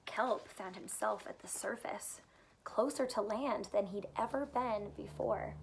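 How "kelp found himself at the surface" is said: hushed whisper for a tense moment; whispered suspense; storybook whisper. A narrator's reading. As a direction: drop to a breathy hush, drawing kids in.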